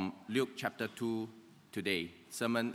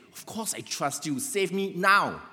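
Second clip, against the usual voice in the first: impatient; 8 semitones higher, 5 dB louder than usual. Retold or imitated. Dangling man answers God's question, impatient and building to a shout. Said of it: "getting a bit impatient".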